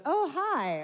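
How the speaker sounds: put-on high, eerie little-girl greeting; haunting toddler. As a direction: imitate a creepy child at the bedside. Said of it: "And it's haunting".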